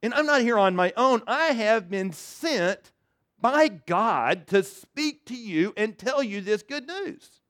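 the archangel proclaiming his commission from God; solemn Gabriel. Acted. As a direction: lay down each word like a royal decree. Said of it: "He says, he says".

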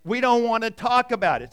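loud, huffy brush-off; grumpy fishermen. Retold or imitated. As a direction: snap back like sulky fishermen who caught nothing. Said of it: "as loving fishermen, they say".

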